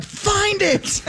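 retold panicked order. Retold panicked order about missing finger.

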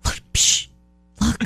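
hushed, nudging aside to a friend; whisper-nudge about a celebrity; a hint of an excited secret whisper; shy starstruck fan. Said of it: "the other people who go".

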